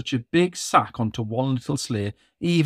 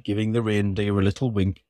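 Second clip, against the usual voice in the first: ordinary delivery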